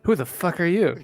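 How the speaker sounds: sudden loud outburst, caught off guard; startled shout. Acted. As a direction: blurt it out in shock. Startled by strange dude.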